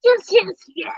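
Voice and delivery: excited. Real, not acted. Excited child shouting agreement.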